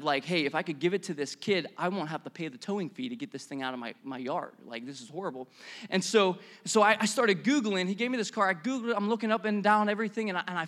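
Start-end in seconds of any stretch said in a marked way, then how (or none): 0.2-5.5 low, soft muttered calculation; private scheming. Acted. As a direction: grumble it to yourself on the quiet. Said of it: "He actually had the mindset of".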